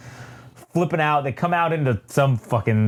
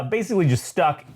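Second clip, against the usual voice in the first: normal talking voice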